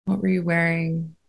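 unhurried, steady phrases, spaced out to let them breathe; guided meditation. Acted.

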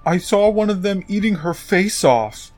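mock horror. Chuck reports what he saw to the college kids, mock horror. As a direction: raise the pitch in pretend horror.

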